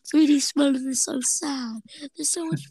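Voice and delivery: high, slow, woeful cartoon voice; mock upset. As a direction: wail it slowly like a miserable cartoon character. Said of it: "is very upset".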